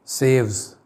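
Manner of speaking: "सेव्स" said as safes, ending in fs; teacher's model word "सेव्स"; pronouncing safes. Shown as a demonstration. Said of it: "तिजोरियाँ".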